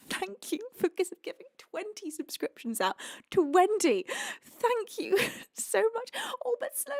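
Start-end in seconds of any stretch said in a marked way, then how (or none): 4.4-6.9 thanking while welling up; tearful thanks. Real, not acted.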